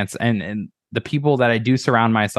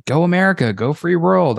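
sarcastic rah-rah, higher and louder; mock patriotic cheer; 6 semitones higher, 3 dB louder than usual. Podcaster mocking flag-waving, sarcastic higher louder cheer. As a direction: cheer it like a hollow chant.

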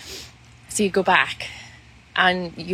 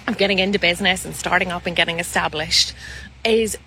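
usual speaking voice

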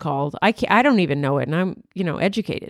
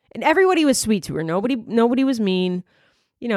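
ordinary delivery

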